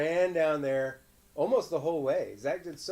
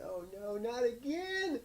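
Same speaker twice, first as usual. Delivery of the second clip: high, slow drawn-out whimper; imitating hurt child; 8 semitones higher, 5 dB quieter, slower than usual. Parent telling viewers the story, slow high whimper. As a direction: stretch it out like a sad kid. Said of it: "when he said".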